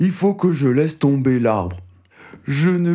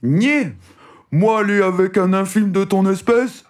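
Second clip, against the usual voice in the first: scornful, higher and louder; contemptuous giant; 7 semitones higher, 3 dB louder than usual. Acted. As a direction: sneer down at the little man. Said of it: "d'un air méprisant".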